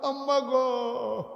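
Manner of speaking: high, drawn-out call to mother; acted beseeching call. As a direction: call her imploringly, stretching the words. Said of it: "Umar ডাক দেয়া কয়".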